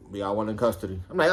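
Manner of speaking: ordinary talk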